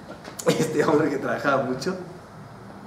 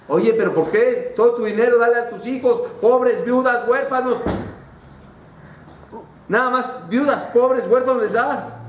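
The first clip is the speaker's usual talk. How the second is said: higher, pointed questioning voice; challenging questioner; 7 semitones higher than usual. Acted. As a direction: needle him about where the money goes. Questioner challenges the generous man.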